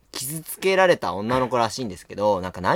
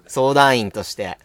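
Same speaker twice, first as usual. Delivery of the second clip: normal talking voice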